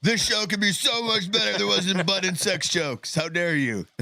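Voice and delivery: high put-on whiny heckler; mocking chat voice. Reading a chat comment aloud.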